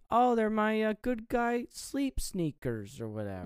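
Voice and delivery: put-on high, slow little boy voice; lightly voiced small boy; little kid voice. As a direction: pipe up like a small innocent child. Child Andy voiced in retelling.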